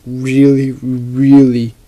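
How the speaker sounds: ordinary talk, nothing marked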